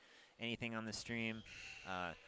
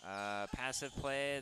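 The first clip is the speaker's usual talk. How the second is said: ordinary talk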